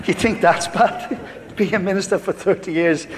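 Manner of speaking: amused, chuckling one-upmanship; joking through laughter. Retold or imitated. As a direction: laugh through the wry comeback. Joking about church people, laughing.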